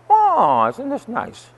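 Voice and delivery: mock delight, dripping irony; priest's high sarcastic aside about the angry man. Real, not acted. Sarcastic reaction to the man's anger.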